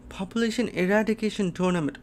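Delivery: high, quick astonished reading; stunned disbelief. Real, not acted. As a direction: reread it fast in high-pitched disbelief. Rereads shocking tournament name.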